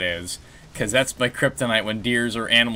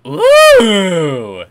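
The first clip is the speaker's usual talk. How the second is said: loud, high drawn-out ooh; a big wowed ooh; impressed ooh; 8 semitones higher, 12 dB louder than usual. Real, not acted. Friend seeing the new dinosaur look, loud impressed ooh. Admiring new game patch.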